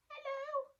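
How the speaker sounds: very high squeaky monster voice; tiny monster. Acted. Tiny monster greeting Natalie, very high squeak.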